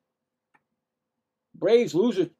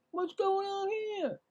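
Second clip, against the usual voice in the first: high, soft puzzled question; startled at the radar reading; much higher, softer baffled ask; surprised; 9 dB quieter, slower than usual. Real, not acted. Surprised by slow pitch speed.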